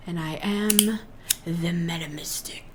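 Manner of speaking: hushed, breathy reveal of the title; dramatic whispered introduction; mystic whisper. Acted. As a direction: whisper it like a secret name. Introducing her mystic persona.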